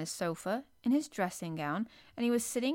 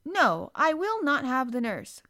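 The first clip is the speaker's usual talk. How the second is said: high, loud, imperious refusal; magnificent; 6 semitones higher, 7 dB louder than usual. A narrator's reading. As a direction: refuse grandly like a young prince. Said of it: "Colin said so magnificently".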